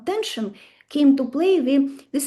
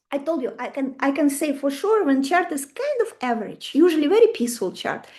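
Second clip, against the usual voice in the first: ordinary delivery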